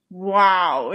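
ordinary delivery

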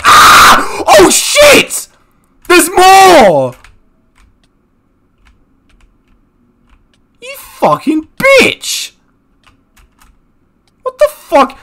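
sudden high, loud panicked shouting; startled yell. Real, not acted. Gamer getting ambushed by bees, panicked high yells. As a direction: yelp in real panic as the swarm hits.

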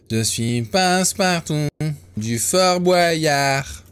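lightly voiced Passe-Partout, slow and drawn out; Passe-Partout impression. Gamer playfully impersonating Passe-Partout from Fort Boyard, slow and drawn out. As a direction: stretch each word like the Fort Boyard character. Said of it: "Magnifique interprétation".

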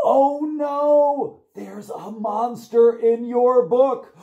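high, loud, slow storybook alarm; mock alarm. Librarian reading to toddlers, high mock alarm. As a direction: gasp it out to thrill the little ones. Reading monster book to kids.

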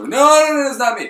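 flustered protest; panicky denial. Retold or imitated.

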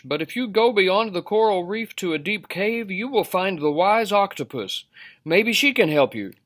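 high, lilting advice-giver; starfish character. A narrator's reading. Starfish advises the fish.

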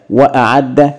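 "وأعد" said "wa-a'adda" with a catch after wa; hamza pronounced. Shown as a demonstration. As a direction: sound the glottal stop before the ayn in "وأعد". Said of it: "وهننطق بالواو أيضًا ونقول". (نطق «وأعد» مع تحقيق الهمزة بعد الواو)

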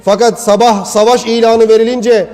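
loud, driving sermon delivery; full-voiced pulpit preaching; preaching at full force. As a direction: preach it out hard to the congregation.

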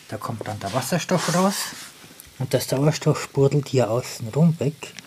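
ordinary talk

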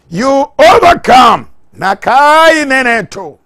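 very high, very loud preacher's cry; explosive tongues-speaking climax; ecstatic shout. Real, not acted. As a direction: cry it out at full force over the church.

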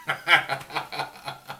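real laugh breaking the line; helpless laugh. Real, not acted. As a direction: crack up laughing. Cracking up mid-punchline.

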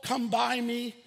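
ordinary delivery

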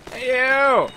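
high, loud holler; loud, high challenge; calling out. Pretend. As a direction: holler at an enemy to get its attention. Calling out an enemy.

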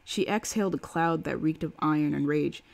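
plain reading voice

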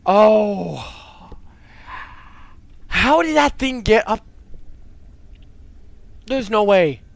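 loud, high, incredulous outburst; shocked disbelief. Real, not acted. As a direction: exclaim in startled disbelief. Monster turned up unexpectedly.

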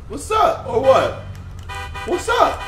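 high, loud "what's up" challenge; loud high taunt. Acted. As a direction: shout the dare way up high. Squaring up to game gang.

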